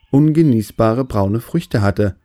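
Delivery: ordinary delivery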